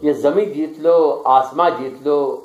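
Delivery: measured, declaiming poetry recital; stately nazm recitation. Acted. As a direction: recite each line with weight. Poet recites his nazm. (پُروقار نظم خوانی)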